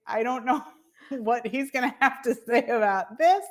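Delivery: giggling through the words; laughing quote. Retold or imitated. To herself about her husband, laughing. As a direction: laugh it out sheepishly.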